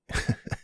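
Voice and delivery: brief, low-key chuckle; soft chuckle. Acted. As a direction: let out a short flirty laugh. Flirtatious chat about tonight's date.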